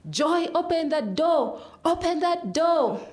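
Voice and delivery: high, stretched-out yelling; insistent high shouts, each word drawn out; joined-in screaming. Retold or imitated. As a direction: scream the order through a shut door, slowly.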